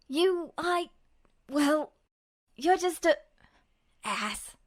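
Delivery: stumbling over the words, too flustered to finish the insult; spluttering in anger. A narrator's reading. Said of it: "he spluttered".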